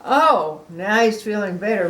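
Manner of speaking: usual speaking voice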